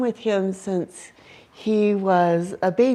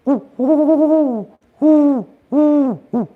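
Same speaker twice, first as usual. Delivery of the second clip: owl response hoot; 7 semitones higher, 7 dB louder, slower than usual. Retold or imitated. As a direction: answer the hoot and drop at the end. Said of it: "then the response is".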